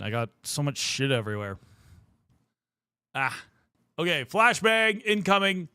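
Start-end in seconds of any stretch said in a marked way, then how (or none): none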